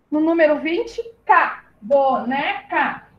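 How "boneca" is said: "Boneca" very slow, as bo, ne, ca; syllable by syllable. Shown as a demonstration. Teacher reading out the formed word, "Boneca" syllable by syllable. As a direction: pull "Boneca" apart into three slow beats. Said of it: "também eram três sílabas".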